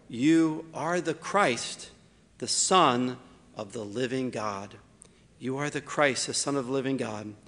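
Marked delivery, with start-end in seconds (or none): 0.1-4.8 slow, weighty declaration with a long hold on "You"; solemn proclamation. Shown as a demonstration. Preacher voicing Simon's confession, slow and solemn. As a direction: draw out "You are the Christ" slowly, each word weighed. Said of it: "something that is so powerful".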